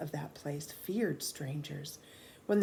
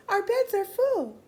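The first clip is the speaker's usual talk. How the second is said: high, louder put-on woman's voice; Louise; 8 dB louder than usual. A narrator's reading. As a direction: squeak it out high and firm. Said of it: "said Louise".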